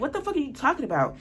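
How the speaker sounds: quick, high-pitched disbelief; exasperated. Retold or imitated. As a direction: throw it back at him, high and fast. Exasperated reply to his text.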